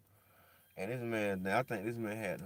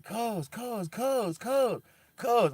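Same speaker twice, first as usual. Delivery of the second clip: calling out, building; 10 semitones higher, much slower than usual. Retold or imitated. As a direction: keep calling his name until it turns into a yell. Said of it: "I was screaming at him".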